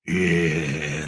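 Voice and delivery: low, drawn-out growl; dog-like growl. Retold or imitated. Film fan imitates the hero turning on two guys, growling. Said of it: "like a damn dog".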